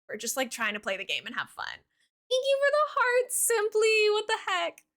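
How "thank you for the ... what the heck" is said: much higher, slow and sing-song; bubbly streamer thank-you voice; high chirpy thanks. Real, not acted. Streamer thanking a chat viewer.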